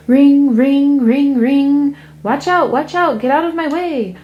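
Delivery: high, loud bell-ringing yell; taxi driver yelling. A narrator's reading. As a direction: clear the road, shouting over the bells.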